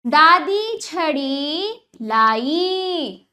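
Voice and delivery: दादी छड़ी लाई very drawn out, word by word; teacher's very slow first reading of दादी छड़ी लाई; slow demonstration reading. Shown as a demonstration. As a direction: stretch every long vowel in दादी छड़ी लाई. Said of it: "अब वाक्यों का उच्चारण करते हैं".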